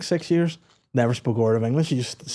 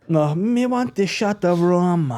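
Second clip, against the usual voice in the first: slow, higher mock Jamaican drawl; fake Jamaican accent; 4 semitones higher, 4 dB louder, slower than usual. Correcting the lyric for a co-host, slow mock Jamaican accent. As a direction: stretch the lyric out in put-on Jamaican. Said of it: "in a fake Jamaican accent".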